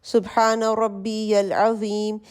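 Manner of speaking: slow, soft prayer recitation; bowing recitation. Shown as a demonstration. Teacher softly recites the bowing phrase in prayer. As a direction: say it gently while bowing. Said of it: "رکوع میں جاتے ہیں".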